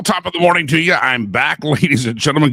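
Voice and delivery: ordinary talk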